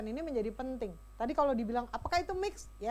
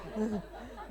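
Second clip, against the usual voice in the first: soft laugh; 6 semitones lower, 6 dB quieter than usual. Real, not acted. Laugh after mock line.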